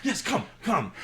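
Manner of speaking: fawning host showing the way; eager minotaur captain. Acted. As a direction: minotaur reassuring the passenger about the bags, high and eager. Minotaur beckoning.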